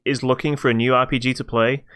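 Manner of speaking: ordinary delivery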